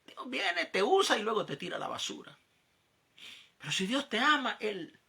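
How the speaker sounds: a mock tempter sneering about God; retold mocking tempter, lightly acted; tempting voice. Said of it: "me decía aquella voz".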